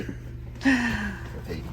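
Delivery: slow, sighed-out reply; sighing. Real, not acted. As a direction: drag it out on a sigh. Sighing at the early hour.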